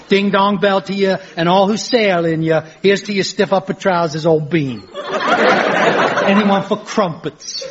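badly faked English accent; ridiculous English accent. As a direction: play a hammed-up jolly Englishman. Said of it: "Why has he got that ridiculous accent?".

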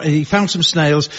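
ordinary delivery

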